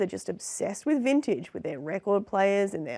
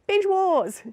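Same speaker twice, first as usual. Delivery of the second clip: high, loud rallying yell; mock battle cry; 10 semitones higher, 6 dB louder than usual. Host challenging Netflix to a drinking contest, mock battle cry. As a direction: yell it like a comic war cry.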